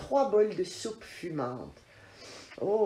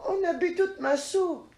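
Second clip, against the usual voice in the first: the little cub shouting, outraged; squeaky angry cub voice; furious little bear; 10 semitones higher than usual. Acted. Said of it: "crie petit ours d'une voix furieuse".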